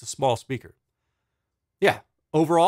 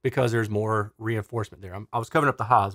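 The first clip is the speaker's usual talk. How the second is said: ordinary talk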